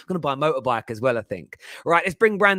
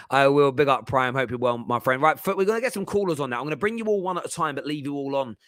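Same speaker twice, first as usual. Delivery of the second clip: usual speaking voice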